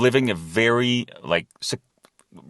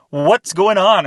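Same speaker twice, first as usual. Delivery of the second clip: loud, high-pitched retold alarm; startled exclamation; 8 semitones higher, 6 dB louder, slower than usual. Driver seeing kids run after the car, loud startled cry. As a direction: blurt it out high and loud, reliving the surprise.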